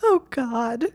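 high, tearful acted wail; wailing through sobs. Hermione bursting into tears with two friends, wailing through sobs. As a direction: wail it out as if sobbing. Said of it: "she wailed through her sobs".

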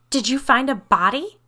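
high, loud shrilling question. A narrator's reading. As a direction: shriek the question in alarm. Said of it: "Hannah shrilled".

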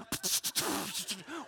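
mouth-made laser zaps; imitated laser blast. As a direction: fire off noisy laser sounds with the mouth. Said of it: "turned this into a laser and went".

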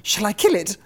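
high-pitched eager question; driver's wife. A narrator's reading. Wife asking about the caught bird, high voice. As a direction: ask in a high wife's voice. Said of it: "Then his wife said".

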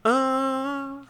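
long, high, soft hesitation sound; pondering. Acted. Townsperson asked an awkward question, pondering. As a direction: stall while thinking it over. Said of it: "Like he's pondering".

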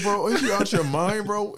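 high, loud outraged friend; mock scolding. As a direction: imitate a friend's incredulous telling-off. Friend scolds him, acted out.

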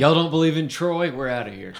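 high, loud huffy outburst; mock offended listener. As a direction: storm off in a huff. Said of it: "This guy's".

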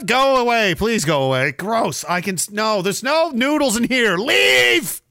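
exasperated, rising to a high loud yell; mock indignant dismissal. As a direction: shoo them off, ending in a yelled order. Acts shooing the hooker off.

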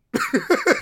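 a real sudden cackle, way up high; loud high laugh. Real, not acted. Laughing at the guard's comeback, a high burst of laughter.

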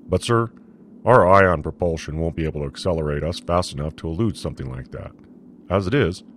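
a tense first officer, objecting; commander voice. A narrator's reading. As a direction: push back on the captain's order. Commander character voice.

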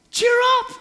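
high, loud cheery call; crowd calling out. Retold or imitated. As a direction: hail him across the crowd. Crowd voiced calling blind man.

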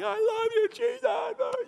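very high, shouted falsetto cry of joy. Retold or imitated. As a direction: scream it up high in overwhelming joy. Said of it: "I'm crying like".

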